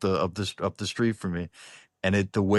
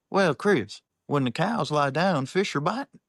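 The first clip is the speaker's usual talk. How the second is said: imitated Cajun accent, pitched high; high Cajun neighbor voice; Jody McGinnis; 7 semitones higher than usual. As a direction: mimic a Cajun neighbor dropping a folksy saying. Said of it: "can I get a Cajun translation".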